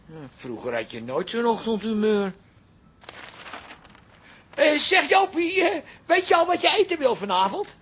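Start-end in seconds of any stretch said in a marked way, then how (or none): 4.5-7.1 calling out. Acted. Father calls out to daughter.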